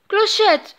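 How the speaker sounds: loud, high anxious call. A narrator's reading. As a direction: call out for her in fear. Peter calls the fallen fairy.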